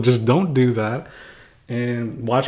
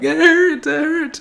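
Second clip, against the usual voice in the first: much higher, hammed-up whimper; faked pain; 3 dB louder, slower than usual. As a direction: pretend it hurts a lot. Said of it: "but it really didn't".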